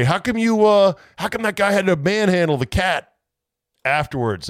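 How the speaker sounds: mock complainer. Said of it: "somebody had to say".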